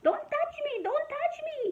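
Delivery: very high-pitched mock shriek; imitated scream. Wife suddenly turning on her father-in-law, imitated scream. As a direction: shriek it high without much added volume. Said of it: "she started to scream".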